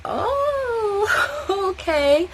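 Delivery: a high thrilled oh with a laugh; high amazed squeal. Retold or imitated. Amazed at the pad.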